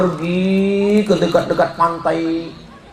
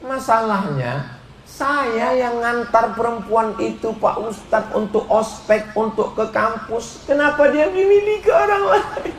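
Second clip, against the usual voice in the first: higher, hurt whine, laughing at the end; jilted complaint; 6 semitones higher than usual. Acted. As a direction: complain like a wounded suitor. (pemuda yang kecewa mengeluh dengan nada tinggi)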